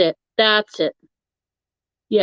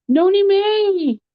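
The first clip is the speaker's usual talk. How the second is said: mock surprise; 11 semitones higher, slower than usual. Mock surprise at viewer's return.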